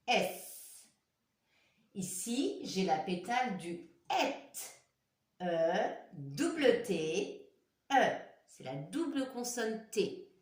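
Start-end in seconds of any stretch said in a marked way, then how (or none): 5.4-8.2 slow spelling. Shown as a demonstration. Teacher spelling for children, slow and clear. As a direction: double T said slowly and clearly.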